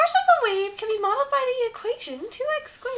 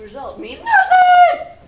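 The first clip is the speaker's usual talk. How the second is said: loud warning; 9 semitones higher, 11 dB louder, slower than usual. Acted. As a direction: shout the warning out. Warning about the asymptote.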